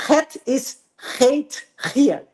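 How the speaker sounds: very slow, deliberate Dutch "Het is heet hier"; Dutch pronunciation. Shown as a demonstration. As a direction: say "Het is heet hier" slowly with rasped throat consonants. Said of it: "o G tem som de R". (holandês bem devagar, "Het is heet hier" com som de R)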